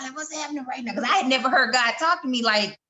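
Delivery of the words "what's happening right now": high, softer mock panic; retold alarm, high and small; freaked out. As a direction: replay a bewildered freak-out in a raised pitch. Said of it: "I was freaking out".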